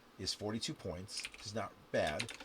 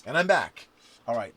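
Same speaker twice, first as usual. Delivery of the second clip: energetic return; 14 dB louder than usual. Real, not acted. Host coming back to his turn, loudly pumped.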